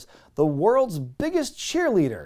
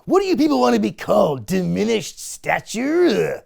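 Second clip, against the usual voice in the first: Triple H voice; 4 semitones higher, 3 dB louder than usual. Retold or imitated. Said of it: "Triple H goes".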